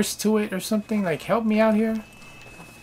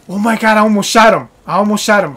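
loud burst of shocked disbelief; panicked, loud outcry; real startled alarm; 2 semitones higher, 10 dB louder, faster than usual. Real, not acted. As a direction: blurt it out after a near miss. Nearly shot horse by accident.

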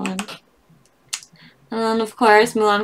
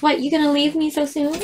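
ordinary talk

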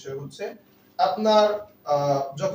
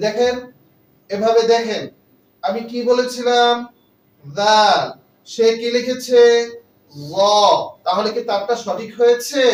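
raised, louder classroom voice; teacher addressing the class; 5 semitones higher, 7 dB louder, slower than usual. Shown as a demonstration. Teacher showing a pupil's mistake to the class, loud and raised. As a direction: call out to the whole room, pointing at the notebook. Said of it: "সকলের সামনে এভাবে খাতাটা দেখানো".

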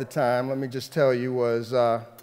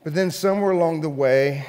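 usual speaking voice

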